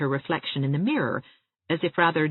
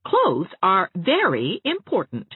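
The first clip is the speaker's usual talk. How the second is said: severe; 4 semitones higher, 6 dB louder, slower than usual. Acted. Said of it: "said Anne severely".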